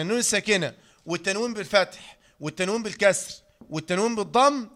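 normal talking voice

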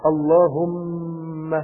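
اللهم with a long held closing م; lips closing on the mim. Shown as a demonstration. Preacher demonstrating the mim sound of اللهم. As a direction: draw out the م of اللهم, lips pressed shut. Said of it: "ضم كده، صح؟".

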